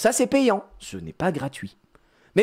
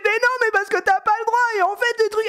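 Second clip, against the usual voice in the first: very high mock child wail; squeaky, protesting imitation; worked-up little girl. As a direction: mimic a kid getting furious and tearful. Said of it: "Elle, elle s'énerve".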